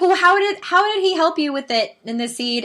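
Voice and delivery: high, loud imitation of a persistent kid; six-year-old daughter. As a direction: mimic a child demanding an explanation. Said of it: "She's like".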